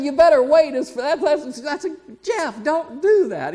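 high, loud, laughing protest; laughing disbelief. Retold or imitated.

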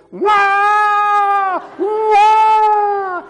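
high, loud mock baby crying wah; imitated toddler wail. Grandpa imitating the toddler's loud crying wail. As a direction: wail like a bawling two-year-old. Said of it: "she breaks out in this".

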